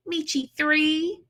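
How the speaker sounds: ordinary delivery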